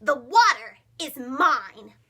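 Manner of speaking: loud, slow, possessive yell. Acted. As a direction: drag out the claim, shouting it. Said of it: "yelled Milton".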